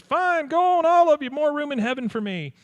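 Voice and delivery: high, loud indignant screech; huffy churchlady. Retold or imitated. Pious neighbor huffing at the departing children, high indignant screech. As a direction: shriek it after the kids as they leave.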